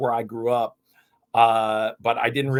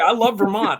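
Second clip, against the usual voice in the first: excited burst; 10 semitones higher, 5 dB louder than usual. Real, not acted. Eager about home state.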